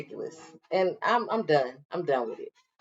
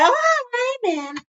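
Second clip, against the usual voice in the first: mock sweet greeting; 12 semitones higher, 4 dB quieter than usual.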